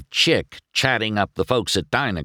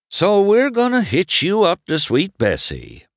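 high, louder, emphatic telling; Doc giving orders; 12 semitones higher, 5 dB louder than usual. A narrator's reading. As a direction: press on over his protest.

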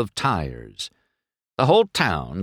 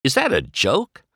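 higher, incredulous rising question; unbelieving; 6 semitones higher than usual. A narrator's reading. McQueen questions Doc's race offer, unbelieving. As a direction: ask it in open disbelief. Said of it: "he said, unbelieving".

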